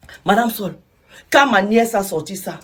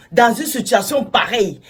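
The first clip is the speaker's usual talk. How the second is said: ordinary talk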